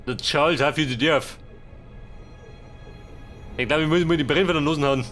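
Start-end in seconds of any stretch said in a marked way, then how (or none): none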